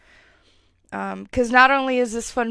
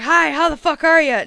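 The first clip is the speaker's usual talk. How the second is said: brash, louder, higher hello; loud rude greeting; 7 semitones higher, 9 dB louder than usual. Retold or imitated. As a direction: bark a crude hi at a stranger. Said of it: "I wouldn't just be like".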